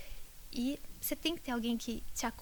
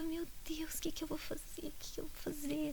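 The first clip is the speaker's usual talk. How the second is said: soft, higher-pitched desperate plea; retold despair; 4 semitones higher, 8 dB quieter than usual. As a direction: replay a hushed moment of despair under the breath. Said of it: "ou de desespero".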